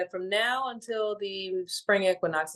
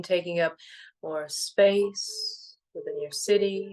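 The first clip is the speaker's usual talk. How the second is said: guided meditation; 3 semitones lower than usual. Real, not acted. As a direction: gently guide them into stillness, letting each phrase settle.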